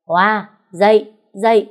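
loud, slow scolding call; harsh wake-up yell. A narrator's reading. Sister-in-law yelling at the sleeping new mother, loud. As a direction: bark her awake, loud and drawn out. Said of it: "nghe giọng ai đó chửi bên tai".

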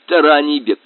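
plain reading voice

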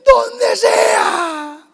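very high shouted cry; Mary's desperate cry. Retold or imitated. Mary in labour answering José, high crying shout. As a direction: cry it out in pain, anywhere at all. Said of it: "¿Qué dijo María?".

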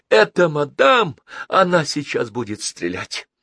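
high, urgent warning cry; cried out. A narrator's reading. Girl warning passengers of coming gunfire, alarmed cry. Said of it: "крикнула Долис".

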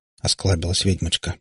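ordinary delivery, nothing marked

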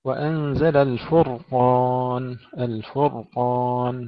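الفرقان recited slowly with a heavy, vowelless ر; heavy silent raa. Shown as a demonstration. Tajweed teacher modelling الفرقان for students, careful heavy ر. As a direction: hold a full ر in الفرقان, no vowel after it. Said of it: "نحافظ على الراء مفخمة وساكنة".

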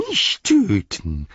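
slow, drawn-out whine; wailing Gollum. A narrator's reading. Gollum begs Sam not to kill him, wailing. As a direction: wail for mercy as a wretched creature. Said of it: "jammerte er".

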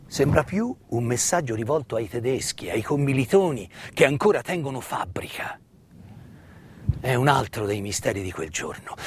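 normal talking voice

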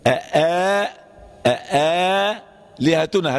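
slow أآ لهتنا with a long alif; hamza changed to alif. Shown as a demonstration. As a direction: draw out أآ as a long a. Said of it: "الهمزة هنا ألفا". (أآلهتنا بمد الألف)

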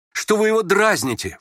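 high, reproachful outcry; sudden cry. A narrator's reading. Prince defending the youth from teasing, crying out. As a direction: burst out in protest. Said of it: "вскричал вдруг князь".

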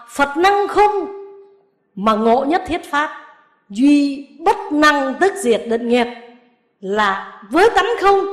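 slow, measured chanting of the verse; sutra recitation. Acted. Nun quoting scripture to her lay students, slow recitation. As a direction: intone the scripture slowly, word by word.

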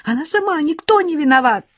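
high-pitched distraught protest; girl crying out her innocence, very high; screaming. A narrator's reading. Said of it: "закричала Арина".

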